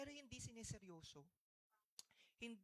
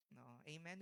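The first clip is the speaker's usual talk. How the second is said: ordinary talk, nothing marked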